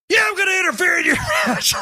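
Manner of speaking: high, fast mock shout breaking into a laugh; acted Hangman quote. Hangman shouting back at Swerve, laughing. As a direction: bellow it back as cocky Hangman. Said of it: "the Hangman goes".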